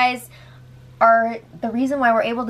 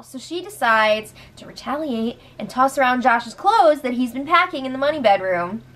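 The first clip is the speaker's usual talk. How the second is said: ordinary talk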